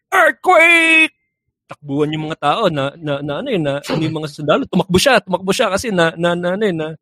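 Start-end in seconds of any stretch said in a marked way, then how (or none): 0.1-1.1 high, loud yell of earthquake; shouted warning. Retold or imitated. Cory at the firing range, shouting to distract soldiers. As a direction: scream it to scatter the soldiers. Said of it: "biglang sumigaw si Cory".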